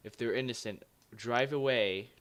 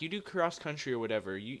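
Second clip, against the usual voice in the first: ordinary talk, nothing marked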